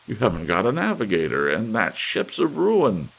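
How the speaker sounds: anxious man pleading against it; protesting man. A narrator's reading. Thurkell protests.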